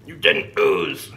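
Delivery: slow, low mock-dramatic line; deep mock voice. Teasing the cookie maker in a deep slow voice. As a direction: drop the voice way down and drag it out. Teasing echo of partner's joke.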